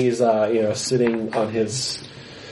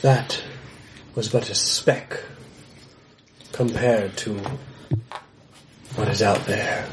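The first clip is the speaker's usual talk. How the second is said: gruff mountain man; 3 semitones lower than usual. Acted. Hermit speaks with pauses.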